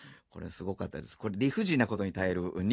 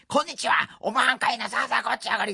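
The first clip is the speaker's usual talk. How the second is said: high, loud rakugo shout; big loud voice; 12 semitones higher, 5 dB louder, faster than usual. Retold or imitated. Practising rakugo loudly by the river. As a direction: belt the rakugo lines across the river. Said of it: "でっかい声で".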